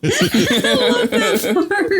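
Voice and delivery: laughing through the words, slow and high. Real, not acted. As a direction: giggle while saying it. Laughing at a funny scene.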